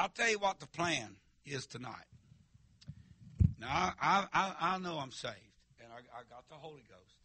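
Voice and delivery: ordinary delivery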